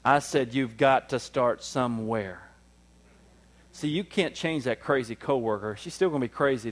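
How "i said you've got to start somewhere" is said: slow insistent repeat, stress on I; slowed repeat to an inattentive crowd. Shown as a demonstration. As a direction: say it again slowly for emphasis. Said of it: "let me say it slow so I could say it some more".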